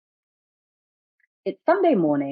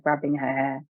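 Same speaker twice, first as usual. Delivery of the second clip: usual speaking voice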